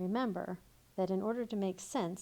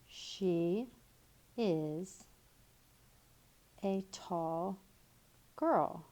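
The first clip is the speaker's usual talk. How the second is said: slow, word by word; much slower than usual. Shown as a demonstration. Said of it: "That could be a sentence".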